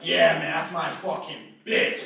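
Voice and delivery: slow, high, hammed-up macho shout; loud boasting. Last guy to get to her brags, shouted macho boast. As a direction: bellow it with swaggering pride.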